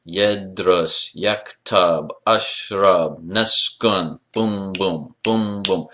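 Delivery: Yedrus, yaktab, ashrab, naskun in two even beats, then boom boom; two-syllable rhythm. Shown as a demonstration. Teacher drilling measure one, Yedrus to boom boom in two-beat chant. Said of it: "Two syllables".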